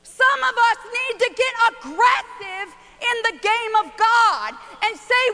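ordinary delivery

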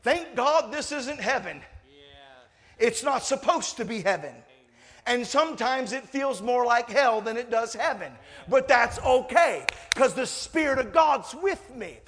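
loud, slow preacher's build to a high shout; rally the congregation, punching each phrase; rising revival-style proclamation; full-force preaching. Real, not acted.

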